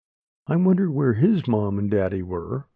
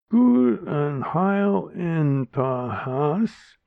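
very slow, higher slurred mimicry; imitating drunk uncle; 6 semitones higher, much slower than usual. Crying girl repeats uncle's words, slow and slurred. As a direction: copy his slurred words. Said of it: "I repeated".

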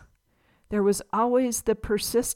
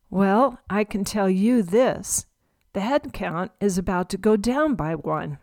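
ordinary delivery, nothing marked

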